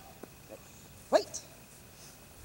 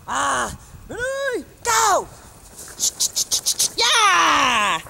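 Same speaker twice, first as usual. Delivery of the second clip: ordinary delivery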